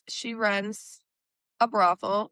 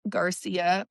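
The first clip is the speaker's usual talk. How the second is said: ordinary talk, nothing marked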